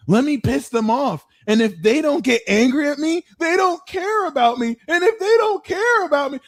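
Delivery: high, needy whiny voice; water sign impression. Water sign begging for attention, high and whiny. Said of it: "Water will be like".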